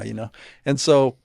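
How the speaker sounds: ordinary talk, nothing marked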